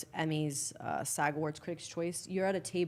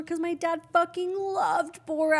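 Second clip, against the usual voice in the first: high, rising mock wail; mock crying; 10 semitones higher, 4 dB louder than usual. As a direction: wail it like you're retelling a sobbing fit. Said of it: "I start crying even more".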